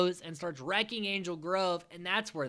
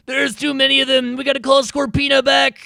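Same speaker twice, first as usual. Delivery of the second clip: higher and louder, urgent; villain alarm; 10 semitones higher, 12 dB louder than usual. Retold or imitated. Goldar ordering Scorpina back, loud mock panic. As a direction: shout the retreat order like a cartoon villain. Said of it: "Goldar's like".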